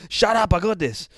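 high, loud, impatient retort; shouted brush-off. Acted. Mister Buttons silences the warning narrator, shouting impatiently. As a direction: yell back over the warning. Hero snaps back at narrator.

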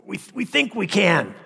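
high, loud mad villain voice; unhinged villain. Pretend. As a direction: gloat like a crazed supervillain. Mock villain boast, sermon joke.

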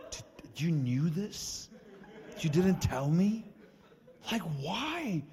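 high, loud, drawn-out incredulous questions; imitated upset protest. Friend confronts preacher over being kept uninformed, imitated upset protest. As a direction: act out a friend's indignant complaint, pitch climbing. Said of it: "He was, like, legitimately upset".

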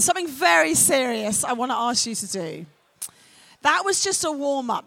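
ordinary talk

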